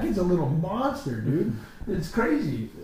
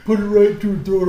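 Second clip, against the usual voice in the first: laughing; 4 semitones higher, 9 dB louder than usual. Retold or imitated. Acting out wild story.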